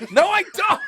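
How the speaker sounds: high squealing laugh through words. Real, not acted. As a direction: crack up while denying. Denies, bursting into laughter.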